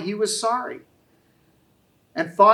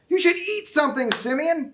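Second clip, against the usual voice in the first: joking; 8 semitones higher than usual. A narrator's reading. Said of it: "both of them joked".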